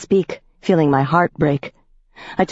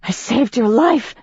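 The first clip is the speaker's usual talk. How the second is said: rasping; 7 semitones higher than usual. Acted. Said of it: "I rasped".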